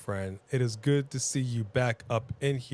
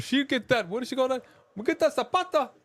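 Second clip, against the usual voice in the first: high, far-off mimicking voice; high imitating voice; 10 semitones higher, faster than usual. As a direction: do a high pitched impression. Imitating Rock's mom.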